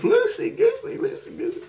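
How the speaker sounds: very high squeaky chant; mimicked running kid. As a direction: chant it like a kid tearing around. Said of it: "she's just running around the house".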